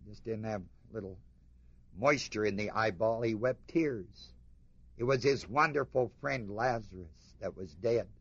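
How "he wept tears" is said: slow, with weight landing on "tears"; deliberate, slowed stress on "tears"; slow emphasis. Acted. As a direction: lean on "tears", draw the line out. Repeats for emphasis.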